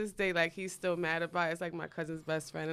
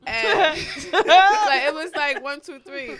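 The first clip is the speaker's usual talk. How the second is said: laughing through the words, higher and louder; 8 semitones higher, 14 dB louder, much slower than usual. Real, not acted. Telling cohosts about the punches, laughing hard. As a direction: tell it through a burst of laughter.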